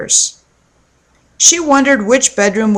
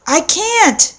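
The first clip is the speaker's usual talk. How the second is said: shouting; 8 semitones higher than usual. A narrator's reading. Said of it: "she shouted".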